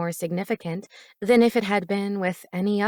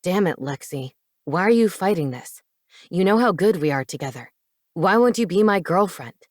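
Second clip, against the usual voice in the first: put-on louder, exasperated pleading young man; frustrated man's voice; 4 dB louder than usual. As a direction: push back in frustration.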